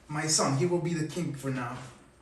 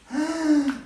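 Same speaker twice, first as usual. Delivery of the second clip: amazed gasp; 9 semitones higher, 5 dB louder than usual. Acted. Monster amazed.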